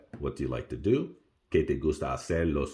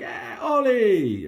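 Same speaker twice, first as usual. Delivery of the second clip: high, loud delighted call to a friend; greeting a friend walking toward you, delighted; excited greeting; 9 dB louder, much slower than usual. Retold or imitated. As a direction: spot a friend and call out happily. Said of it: "you're walking up on a friend".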